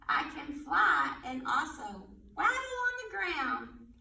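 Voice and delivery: put-on riddle read aloud for little kids; reading to children. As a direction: read it like storytime. Reads animal riddles to children.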